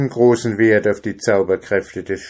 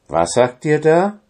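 higher, aghast question; horrified; 7 semitones higher, 4 dB louder than usual. A narrator's reading. Dwarf hears the alarming rhymed message, horrified. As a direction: ask it in startled dismay. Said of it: "fragte er entsetzt".

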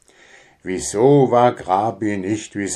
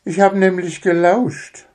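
put-on much higher little boy voice; small child; 10 semitones higher than usual. Little son confesses to father, sheepish.

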